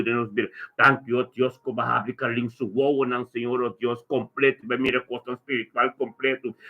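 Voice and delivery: ordinary talk, nothing marked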